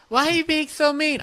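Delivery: put-on high whiny girl voice; hurt girl. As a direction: play her wounded and pleading, high. Acted girl's hurt reply.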